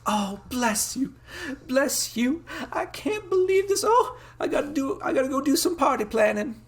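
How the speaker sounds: very high falsetto country mother; high, overjoyed folksy mom voice; grateful farm mom. Acted. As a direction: gush with relief like a farm lady. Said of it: "accent I'm, like, flicking through".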